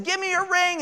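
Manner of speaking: high-pitched mock demand; retold yell. Robber demanding the rings from the couple, mock yelling. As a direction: imitate a robber's yell, held back in volume. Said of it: "they're just yelling".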